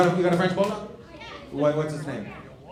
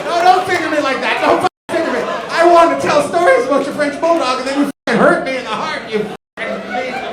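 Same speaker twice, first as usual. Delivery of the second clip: loud, high-pitched comic tirade; mock outraged rant; 8 semitones higher, 13 dB louder than usual. Comic to dead bulldog's owner, hammed-up hurt and shouting. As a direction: yell it as a wounded, over-the-top protest.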